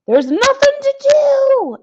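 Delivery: high, loud squeaky elf cry; exclaiming elves. Acted. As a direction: elves blurting out in a fluster. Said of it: "exclaimed the elves".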